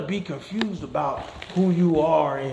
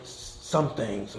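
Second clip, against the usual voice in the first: normal talking voice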